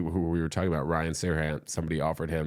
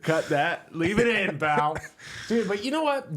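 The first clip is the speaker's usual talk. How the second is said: excited; 13 semitones higher, 3 dB louder than usual. Real, not acted. Laughing, higher, louder to producer.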